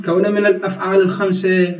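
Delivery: usual speaking voice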